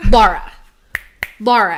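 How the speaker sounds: loud sharp call of her name. Retold or imitated. Calling out to a dazed friend, loud. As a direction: call Laura loudly to rouse her.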